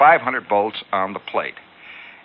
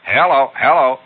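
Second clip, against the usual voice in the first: mic test call; 4 semitones higher, 6 dB louder than usual. Acted. Acted microphone test on transmitter.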